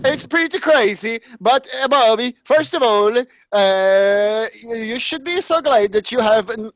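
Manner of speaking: lilting mock-Swedish puppet voice; Swedish Chef. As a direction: ramble with a sing-song fake Swedish accent. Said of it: "I am the Swedish chef".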